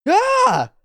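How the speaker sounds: very high, loud yelp; mock scream. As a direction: yelp as if caught in gunfire. Character cries out amid gunfire.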